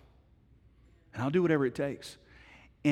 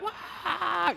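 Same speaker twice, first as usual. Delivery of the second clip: high mock baby wail; imitated toddler wail. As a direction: let out a child's cry. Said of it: "finally it comes out".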